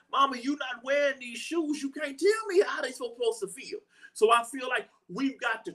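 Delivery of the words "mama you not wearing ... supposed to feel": put-on higher-pitched whiny kid's protest, half-shouted; kid protesting mama. Child refusing the shoes, telling mama she can't know.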